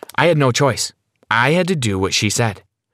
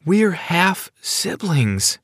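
high, slow, stunned realisation; dazed; 8 semitones higher, much slower than usual. Acted. Sarah learns about her boyfriend, dazed. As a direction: say it slowly, as if in a daze. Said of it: "in a dazed state".

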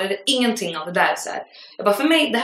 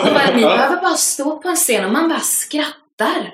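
higher, bright-eyed imitation of the student gushing; mock gushing student; 4 semitones higher, 4 dB louder than usual. Imitating the student at the premiere party, amused and gushing. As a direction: play her delighted and clueless.

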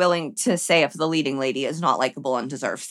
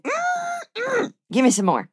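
mock savoring hum, pitched higher; savoring mock relish. Imagined drinker savoring fries-flavoured antifreeze, mock relish. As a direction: relish it like a tasty treat.

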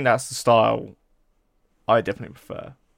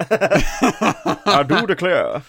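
higher, very slow mock Southern American; American accent; 5 semitones higher, much slower than usual. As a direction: draw it out like a hammed-up Southern American. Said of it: "I can't do an American accent".

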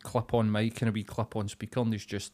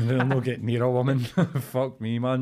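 louder, talking through laughter; real amused laugh in the words; 5 dB louder than usual. Real, not acted. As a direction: crack up while saying it. Laughing at own joke.

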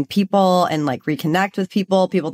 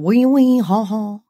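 mock French; 2 semitones higher, slower than usual. Traveler joking about the time in Paris, mock French. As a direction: put on a cartoon French accent. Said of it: "I said a lot of".

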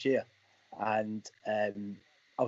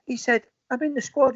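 Macca's outburst; 9 semitones higher, 3 dB louder than usual. Retold or imitated. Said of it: "and he says".

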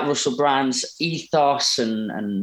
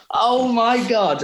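mild drawn-out, higher-pitched amazement; retold starstruck shock; 7 semitones higher, 3 dB louder, slower than usual. Fan spotting his idol in a bar, retold amazement. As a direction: stretch it out, imitating your own disbelief.

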